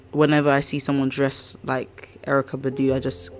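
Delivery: usual speaking voice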